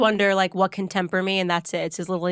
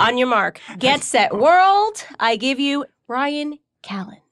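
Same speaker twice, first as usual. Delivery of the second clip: loud, higher, drawn-out introduction; show announcer; 4 semitones higher, 3 dB louder, much slower than usual. Pretend. Host hands the guest to the world, mock-grand announcer. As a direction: build like an emcee presenting the act.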